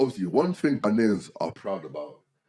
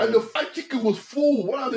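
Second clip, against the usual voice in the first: outraged; 12 semitones higher, 5 dB louder than usual. Real, not acted. Outraged about missing chicken.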